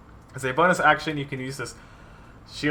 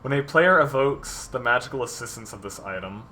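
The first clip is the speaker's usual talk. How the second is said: ordinary talk, nothing marked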